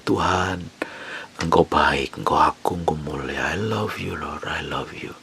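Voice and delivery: soft, hushed worship words fading away; whispered love to God; intimate prayer. Shown as a demonstration. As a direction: pray it tenderly, almost to yourself. Preacher demos intimate worship prayer.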